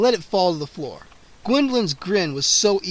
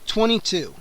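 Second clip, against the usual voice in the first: ordinary delivery, nothing marked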